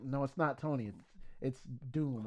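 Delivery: low, soft, understated smugness; snarky villain reveal. Retold or imitated. Imagining Doom correcting the old heroes, low understated snark. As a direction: drop it low and quiet, mildly snarky. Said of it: "witty, snarky thing where he's like".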